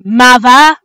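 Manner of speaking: loud scoffing retort; sarcastic. Acted. Crew member mocking the captain's obvious remark, loud sarcasm. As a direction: throw it back, obviously sarcastic.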